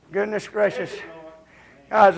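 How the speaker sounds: usual speaking voice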